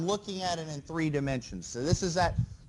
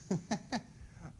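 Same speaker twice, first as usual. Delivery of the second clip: short laugh; 3 semitones higher, 9 dB quieter than usual. Real, not acted. Laughs at own joke.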